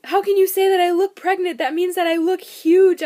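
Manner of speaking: very loud, high mock wail; imitating an upset, hurt outcry; retold tearful outburst. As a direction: protest like a hormonal wife, hammed up. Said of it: "I busted into tears".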